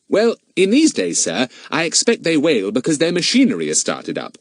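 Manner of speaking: lightly voiced schoolboy, starting high; a hint of a cheeky boy; Fatty, knowing boy. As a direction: suggest it politely, a boy who doubts. Fatty challenging the owner.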